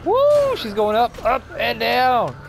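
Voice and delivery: very high, loud whoop; excited cheer. Real, not acted. Player cheering a car flipping in the game, loud whoop. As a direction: whoop it up as she flies.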